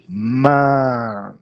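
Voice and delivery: MAN held long, A and E together; stretched blended vowel. Shown as a demonstration. Teacher showing how to say man, long blended vowel. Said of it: "Você vai esticar".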